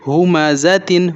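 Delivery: slow, drawn-out ہومزتن with each syllable stretched; slow recitation. Shown as a demonstration. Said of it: "وقف دوران کیا پڑھیں گے؟". (آہستہ کھینچ کر ہومزتن)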